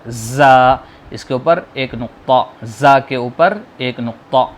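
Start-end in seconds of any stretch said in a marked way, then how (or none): 0.1-0.7 زا said loud and sudden; abrupt, loud زا; with a jolt. Shown as a demonstration. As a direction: snap out زا with a jolt. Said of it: "جھٹکے سے ادا ہوتا ہے".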